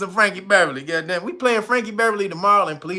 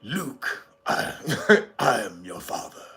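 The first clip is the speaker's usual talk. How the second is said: very deep, soft Vader voice; Darth Vader; 9 semitones lower, 6 dB quieter than usual. Retold or imitated. Vader telling Luke the truth, very deep and hushed. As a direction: rumble it low and quiet. Famous Vader line, quoted.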